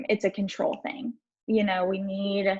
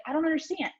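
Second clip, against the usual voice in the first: rising higher, desperate exasperation; telling mom on the phone, pitched-up frustrated pleas; upset and pleading; 7 semitones higher than usual. Retold or imitated. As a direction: vent to mom in frustrated despair. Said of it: "I had this same energy. I was so pissed".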